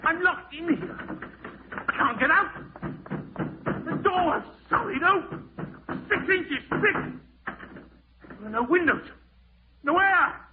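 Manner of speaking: high, loud panicked cries; rising panic. Acted. Man locked in the vault panics aloud, high and loud. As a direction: realise you are sealed in and panic.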